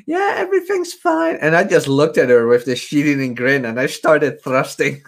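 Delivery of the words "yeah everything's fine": high, falsetto fake-casual girl voice; squeaky pretend-innocent phone voice; imitating girlfriend on phone. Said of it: "She's like".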